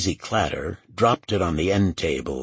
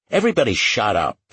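quick, louder command; firm order; 5 semitones higher, 5 dB louder, faster than usual. A narrator's reading. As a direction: cut off the bickering, higher and fast. Lucas orders bickering colleagues quiet.

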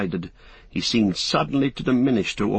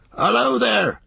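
higher, louder hail into the fog; calling out; 13 semitones higher, 4 dB louder than usual. Acted. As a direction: call out across the mist. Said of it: "cried Farmer Maggott".

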